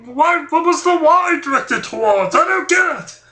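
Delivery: squeaky badgering voice; whiny high questioning; high character voice.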